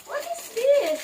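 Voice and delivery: put-on high, curious question to the toddler; playful wonder. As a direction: make the baby curious.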